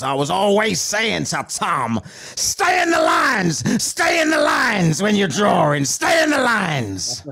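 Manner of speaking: slow, drawn-out mock nagging voice; art teacher. Art teacher nagging Tom about his drawing, mock scolding. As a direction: chant it out like a hammed-up nagging teacher. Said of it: "his art teacher popped up and she goes".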